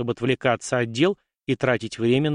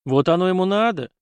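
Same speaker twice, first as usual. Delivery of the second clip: raised-pitch grumble of annoyance; exasperated snort; 7 semitones higher, 4 dB louder than usual. A narrator's reading. Nephew grumbles at the emperor's summons, exasperated. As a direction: snort it out, unable to hold back. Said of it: "не удержавшись, фыркнул парень".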